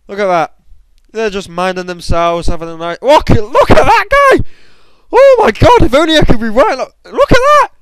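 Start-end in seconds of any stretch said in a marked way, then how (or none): 3.0-7.7 high, loud squeals of disbelief at the screen; squealing gamer watching carnage; excited shriek. Real, not acted. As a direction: shriek with real excitement, much higher and louder. Shrieking at battle replay.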